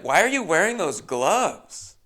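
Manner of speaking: high teasing host voice; flirty host. Retold or imitated. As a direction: lay the innuendo on. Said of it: "And she's like".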